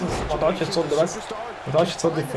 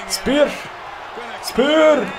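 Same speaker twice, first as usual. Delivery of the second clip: high, loud, drawn-out yells; hype shout; 8 semitones higher, 5 dB louder, much slower than usual. Real, not acted. Gamer shouting his finisher at the game. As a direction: bellow the move name at the screen.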